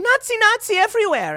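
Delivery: high, loud mock alarm; hammed-up shrieking journalist; mock screaming. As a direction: shriek it like a panicked crier. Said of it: "a journalist who kept screaming".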